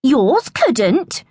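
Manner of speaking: high taunting comeback; jeering. A narrator's reading. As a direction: rub it in with a jeer. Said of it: "jeered Royston".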